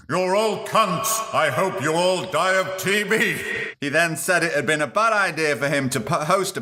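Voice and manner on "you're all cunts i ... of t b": drawn-out, higher-pitched mock rant; mock tirade. Podcaster acting out Brian Blessed's reported outburst at farmers, mock tirade. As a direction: rant it slowly as the irate actor. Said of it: "responded with an expletive-laden tirade".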